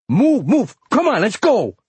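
high, urgent shouted commands; barked orders. A narrator's reading. Robber leader rushing the crew out with the cash, barking. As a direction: bark it to hurry everyone out. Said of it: "barked the leader".

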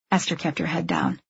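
usual speaking voice

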